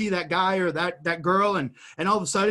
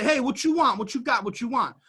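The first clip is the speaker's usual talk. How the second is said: mock a jacket seller hawking wares; New York street hustler; 5 semitones higher than usual. Imitating a New York street seller calling out to passers-by. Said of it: "they say".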